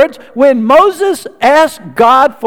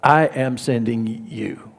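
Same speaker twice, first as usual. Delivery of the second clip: God's voice from the bush, low and much softer; 6 semitones lower, 4 dB quieter than usual. Retold or imitated. God calls Moses from the bush, low and hushed. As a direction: speak like a solemn, hushed divine voice. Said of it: "the voice from the bush says".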